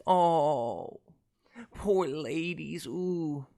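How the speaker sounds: loud, hammed-up fake sympathy, drawn out; mock pity. Podcaster taunting lonely single women after Valentine's Day, sarcastic mock sympathy.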